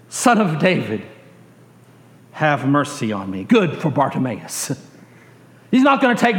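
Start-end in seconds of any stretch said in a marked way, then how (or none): none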